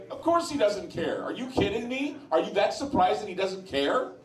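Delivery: high amused disbelief through laughter; laughing scoff. Retold or imitated. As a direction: laugh it off as if the answer is obvious. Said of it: "And I was laughing, going".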